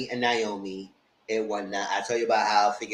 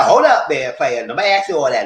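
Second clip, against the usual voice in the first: louder, pushy protest; mock indignant; 2 semitones higher, 7 dB louder than usual. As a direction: push back hard on him. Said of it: "He said".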